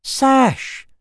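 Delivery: high, loud joyful call; delighted exclamation. A narrator's reading. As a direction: shout her name with delight. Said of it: "the boy exclaimed in delight".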